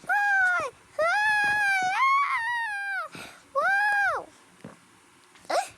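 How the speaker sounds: very high, loud laughing shrieks; shrieking. Pretend. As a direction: shriek with giddy panic. Chaotic run, shrieking.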